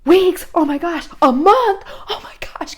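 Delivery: high, loud disbelief, ending in a gasped hush; mock a horrified teenager's outburst; shocked teen reacting to an endless sentence; appalled teen. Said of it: "your teen registers their brain that way".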